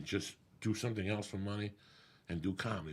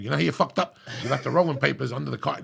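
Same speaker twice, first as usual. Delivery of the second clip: loud, raised-pitch imitation of a dad; mock scolding dad; 4 semitones higher, 10 dB louder, faster than usual. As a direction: belt it out like a dad telling you off. Mock dad giving blunt correction.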